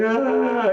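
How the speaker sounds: long, high mock bawl; imitated child wail. Kid refuses to get up from floor, mock wailing. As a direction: keep wailing like a stubborn kid. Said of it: "He just kept crying".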